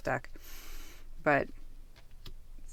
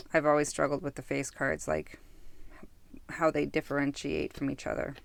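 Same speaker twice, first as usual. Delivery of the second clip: normal talking voice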